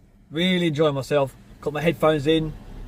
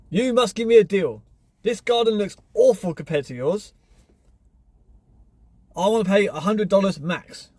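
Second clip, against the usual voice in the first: higher, pushy wife voice; mock demanding wife; 5 semitones higher than usual. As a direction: mimic the wife's haggling with a raised pitch. Neighbour's wife demanding a deal.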